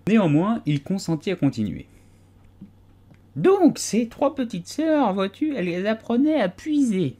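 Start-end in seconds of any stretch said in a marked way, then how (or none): 3.4-7.1 higher, small, drowsy creature voice, a bit slow; a dozy little animal insisting it was awake; sleepy Dormouse. A narrator's reading. Dormouse defending himself to the tea party, drowsy and high. Dormouse resuming his tale.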